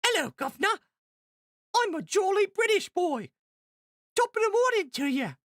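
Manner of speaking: high-pitched little British lad; small jolly British boy. Pretend. Streamer performs a viewer's voice request, small jolly British boy. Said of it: "do your best small, jolly British boy, boy voice".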